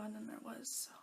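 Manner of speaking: soft whispered tag line; whispered punchline. Real, not acted. Jokes that God lit her video, whispered punchline. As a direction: let the joke fade to a whisper. Mock biblical joke.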